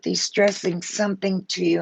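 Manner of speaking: ordinary talk, nothing marked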